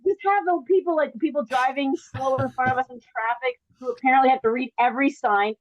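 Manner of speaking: usual speaking voice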